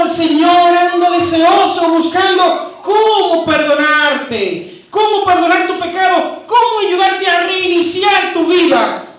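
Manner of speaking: loud, high, jubilant sermon voice; joyful preaching. Acted. Preacher proclaiming God longs to forgive, loud and joyful. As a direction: proclaim God's joy to the congregation. Preacher building to full force.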